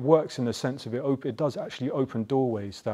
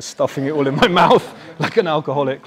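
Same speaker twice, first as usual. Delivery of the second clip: loud, higher, laughing as the words come out; 4 semitones higher, 13 dB louder than usual. Real, not acted. Speaker confessing to secretly stuffing down meat in the park, laughing loudly. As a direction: burst into laughter mid-confession.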